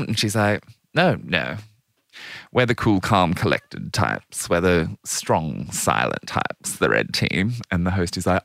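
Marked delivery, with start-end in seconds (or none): 0.9-7.5 slow, unenthused deadpan; Princess Anne, sour. Retold or imitated. Sour royal answering an eager host, deadpan mock refusal. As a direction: refuse all enthusiasm, slow and measured. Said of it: "looks completely sour".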